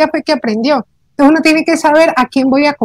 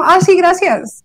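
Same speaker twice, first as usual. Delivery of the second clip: mock careless, higher "Ah, sí, gracias"; insincere thanks; 4 semitones higher than usual. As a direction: toss off the thanks without meaning it. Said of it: "si no es como". (gracias desganadas, poco sinceras)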